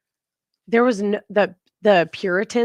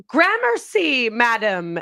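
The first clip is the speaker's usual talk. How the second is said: Ren Fair patron; 2 semitones higher, slower than usual. Retold or imitated. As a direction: play the grand Renaissance guest, stretched out. Grand Ren Fair thanks in character.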